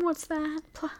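normal talking voice

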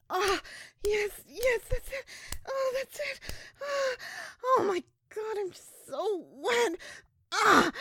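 high, loud cries of pleasure; ecstatic cries. Acted. Crying out in pleasure as her lover takes her. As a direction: let the pleasure break out.

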